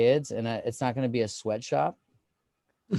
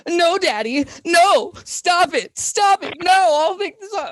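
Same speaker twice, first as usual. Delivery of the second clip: put-on very high, loud, slow pleading child voice; 10 dB louder, slower than usual.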